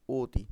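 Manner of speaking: "অতি" said o-ti, with a rounded close o; closed o sound. Shown as a demonstration. Teacher giving pupils the spoken form, closed o. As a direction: round the first vowel of "অতি" to o. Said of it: "ও এর মতো".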